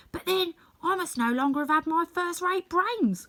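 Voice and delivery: high, eager Scarecrow asking to be praised. Acted. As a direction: play the Scarecrow fishing for a compliment, pitched high.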